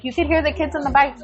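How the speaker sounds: ordinary talk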